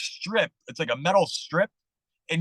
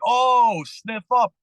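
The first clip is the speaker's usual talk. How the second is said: surprised; 6 semitones higher, 5 dB louder, much slower than usual. Retold or imitated. Realizing mistake, mock surprise.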